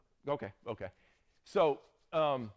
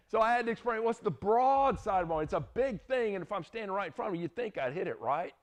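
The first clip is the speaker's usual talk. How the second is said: higher and louder, rushing the middle; animated explaining; 8 semitones higher, 5 dB louder than usual. Real, not acted. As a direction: pump it up, insisting it's obvious. Teacher explaining broadside of barn.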